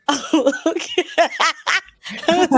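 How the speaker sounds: high, laughing acceptance; awkward okay dissolving into giggles. Retold or imitated. Retells own reaction, breaking into laughter.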